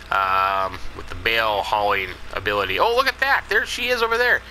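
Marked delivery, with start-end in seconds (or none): 2.8-4.4 much higher, bright exclaim; light up on spotting her; delighted discovery. Real, not acted. Spots Susie hauling bales.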